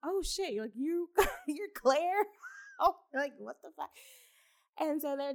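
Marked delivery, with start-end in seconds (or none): 0.0-3.9 mock surprise. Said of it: "She's like".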